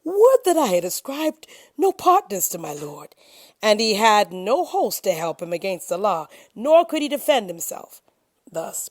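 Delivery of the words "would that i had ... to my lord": a ruined man wailing over his loss; anguished lament. A narrator's reading. The ruined garden owner cries out his regret in anguish. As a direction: cry out in regret, voice breaking, higher and louder.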